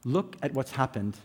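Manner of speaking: ordinary talk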